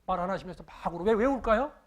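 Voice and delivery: usual speaking voice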